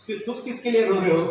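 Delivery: loud, fast, pressing question; pointed question. Acted. Child presses the crying father, loud and fast. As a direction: push the question hard and quick.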